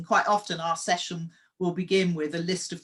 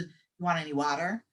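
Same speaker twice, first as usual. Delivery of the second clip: American waitress voice. Retold or imitated. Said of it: "I asked her about six times".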